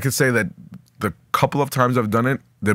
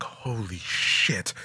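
soft, very slow and stretched; hushed, mind-blown drawl; stunned awe; 7 dB quieter, much slower than usual. Retold or imitated. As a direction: draw it out under your breath. Retold awe near the moon.

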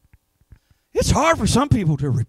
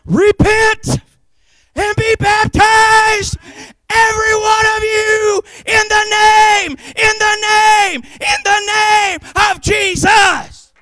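higher, slow, crying shout; preaching pastor; 8 semitones higher, slower than usual. Retold or imitated. Pastor answering the troubled believer, slow crying shout. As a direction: cry it out slow like a pastor at the altar. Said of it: "And the pastor says".

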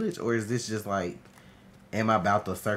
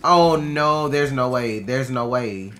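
much louder repeated protest; loud disbelief; 3 semitones higher, 12 dB louder, slower than usual. Real, not acted. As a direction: refuse to believe it out loud. Overwhelmed in a fight.

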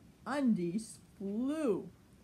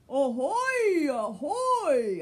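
pirate shout; 6 semitones higher, 9 dB louder, slower than usual. Acted. Pirates spotting a ship.

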